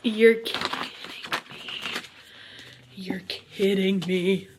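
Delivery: soft voice fading to a slow whisper; awed disbelief. Real, not acted. Reader takes the sleeve off her book, awed whisper. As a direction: breathe it out in stunned disbelief.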